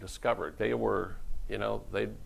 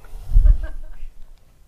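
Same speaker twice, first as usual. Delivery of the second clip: high breathy laugh; 12 dB louder than usual. Real, not acted. Laughing at own joke.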